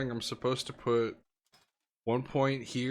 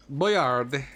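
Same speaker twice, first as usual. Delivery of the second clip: put-on Boyard read like French, dropping the final d-sound ending; French-ish reading; 2 semitones higher, 6 dB louder than usual. Streamer says Boyard in a French-sounding way. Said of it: "What accent did I read that with?".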